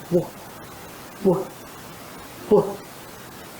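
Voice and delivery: soft unvoiced "Wolf" with rounded lips; breathy wolf sound. Shown as a demonstration. Teacher showing the frightened w sound. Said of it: "she could not say wolf".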